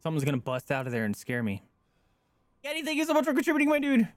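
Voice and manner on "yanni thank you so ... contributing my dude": fast, much higher squeal; high-pitched thanks. Real, not acted. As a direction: rush the thanks out high and loud. Sudden shouted thanks to contributor.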